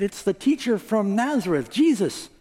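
excited crowd. Acted. Crowd answers the beggar.

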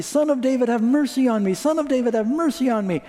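high, loud desperate cry for mercy; shouting at the top of his lungs. A narrator's reading. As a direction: call out with everything you have. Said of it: "he began to shout at the top of his lungs".

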